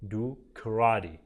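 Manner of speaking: karate said slowly with the t flapped like a d; slow teacher demo of karate as kuh-RAH-dee; American pronunciation. Shown as a demonstration. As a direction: model the American flap in karate.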